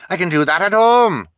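high, loud indignant cry. A narrator's reading. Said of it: "cried the woman with dyed hair".

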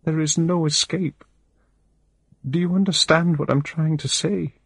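high, wavering voice, crying through every line; a desperate woman sobbing out her despair; tearful distraught woman. A narrator's reading. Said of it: "but I can't stop crying".

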